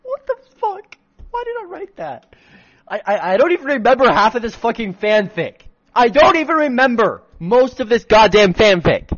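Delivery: real mortified outburst; horrified yelling. Real, not acted. Author horrified by own fanfic.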